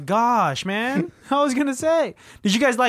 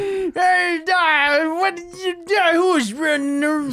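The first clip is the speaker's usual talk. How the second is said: put-on high, slow old miner voice; old prospector; 8 semitones higher, slower than usual. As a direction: keep the grizzled prospector voice going. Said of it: "you sound like an old prospector".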